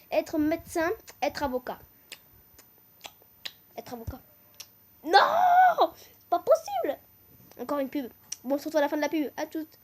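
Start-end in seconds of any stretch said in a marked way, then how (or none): 5.0-7.0 high, loud "Non!"; outraged disbelief. Real, not acted. Vlogger shocked at losing a guess, loud high protest. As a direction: refuse to believe it.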